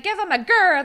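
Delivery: imitating a coach's loud call; rallying coach. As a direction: pitch it up and rally the squad. Retold coach rallying the cheerleaders.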